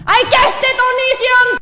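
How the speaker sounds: high, loud fed-up outburst; exasperated shout. Acted. Reader tells Onision off, exasperated shout. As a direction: yell it at the absent author.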